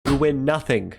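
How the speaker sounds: shouted mock dismissal; loud mock shout. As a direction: boom it out like a gameshow host. Mock game-show dismissal.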